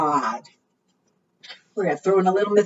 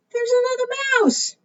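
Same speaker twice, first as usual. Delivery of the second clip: much higher, quick delighted exclaim; excited surprise; faster than usual. Acted. Librarian finds a second mouse for the children, delighted. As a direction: squeal it like a happy find.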